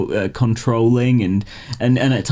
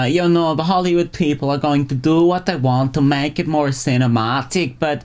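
Milkman voice; 5 semitones higher than usual. Retold or imitated. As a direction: do your Milkman voice as Wiseau. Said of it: "I'm just doing my Milkman voice again".

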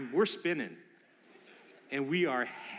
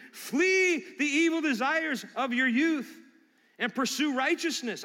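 preaching; 9 semitones higher, 6 dB louder, slower than usual. Acted. Preaching a verse to congregation.

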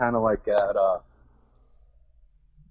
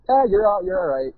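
lightly voiced wife, pitched much higher; imitating wife; 10 semitones higher than usual. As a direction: quote the wife's okay in a higher voice. Wife's approval, quoted by husband.

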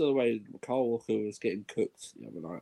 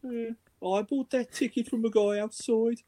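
much higher, put-on Birmingham accent; mock Brummie fan; 12 semitones higher than usual. A fan defending a seat bought outside, hammed-up Birmingham accent. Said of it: "battle of the accents".

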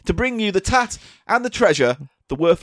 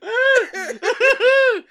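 laughing hard. Real, not acted. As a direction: burst out laughing. Laughing at own joke.

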